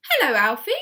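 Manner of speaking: cheery greeting. A narrator's reading. Mister Hoppy greeting the tortoise below, bright and loud. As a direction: call down sweetly to the tortoise.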